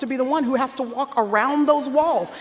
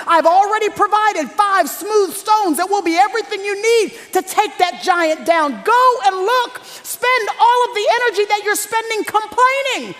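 high, raised exhorting voice; impassioned preaching climax; 7 semitones higher, 4 dB louder than usual. Real, not acted. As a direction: preach it at full force, pitched up high.

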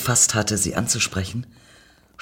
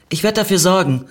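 raised, slightly bolder promise; mildly confident, pitched up; a bit more confident; 7 semitones higher, 4 dB louder, faster than usual. A narrator's reading. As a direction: say it with a touch of self-assurance. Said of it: "ein bisschen mehr Selbstsicherheit".

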